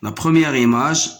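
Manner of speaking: ordinary talk, nothing marked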